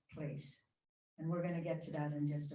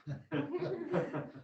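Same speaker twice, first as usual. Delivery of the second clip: amused laugh; 8 semitones higher than usual. Real, not acted. Laughs after we're lazy.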